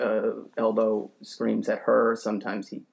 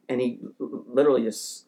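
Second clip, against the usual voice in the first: usual speaking voice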